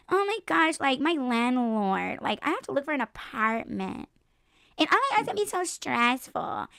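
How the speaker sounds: put-on high, whiny girly voice, slowing on my landlord; hammed-up damsel in distress; mocking gold digger. A woman mocks a gold digger begging a rich man, high and whiny. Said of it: "be like".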